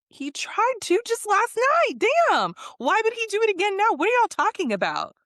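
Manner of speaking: high-pitched, fast exasperated outburst; exasperated protest. Retold or imitated. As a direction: act out an indignant rant, voice shooting up. Acting own heated protest to others.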